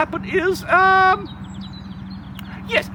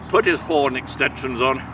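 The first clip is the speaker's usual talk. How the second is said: usual speaking voice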